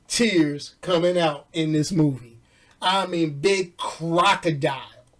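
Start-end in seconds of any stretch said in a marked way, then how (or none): none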